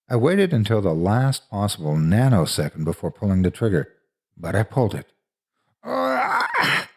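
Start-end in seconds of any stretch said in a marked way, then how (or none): 5.8-6.9 high-pitched cry of pain; pained yell. A narrator's reading. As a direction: yell out as if shocked. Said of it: "Ian yelled".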